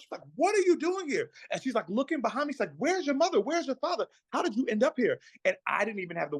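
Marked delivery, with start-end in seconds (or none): 0.4-1.3 much higher, alarmed question; startled grandmother. Retold or imitated. 2.8-5.2 high, urgent string of questions; worried grandmother. Retold or imitated. Grandmother questions the lost boy.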